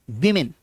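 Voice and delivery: वीमेन said "wi-min", both vowels short I; correct pronunciation. Shown as a demonstration. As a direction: open वीमेन with "wi", not "wo". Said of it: "W के बाद आई है".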